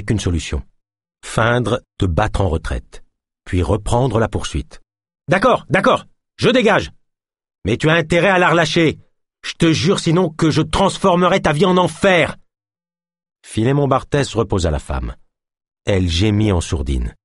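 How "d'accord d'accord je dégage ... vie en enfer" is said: shouted retreat and threat. Acted. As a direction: give in but warn him hard. Cop backs off, threatening.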